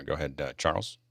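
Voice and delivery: ordinary talk, nothing marked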